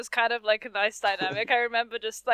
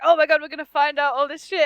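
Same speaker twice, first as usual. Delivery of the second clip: mildly excited imitation, a bit louder; 6 semitones higher, 7 dB louder, faster than usual. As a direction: retell their eager rush. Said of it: "really excited to see".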